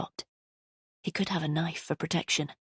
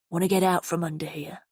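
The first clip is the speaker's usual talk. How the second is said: East End rasp. A narrator's reading. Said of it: "His voice was low and raspy".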